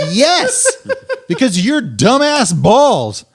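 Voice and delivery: high, drawn-out mock rage; retold exasperated outburst. Husband blaming his friend for wife's tears, high exasperated outburst.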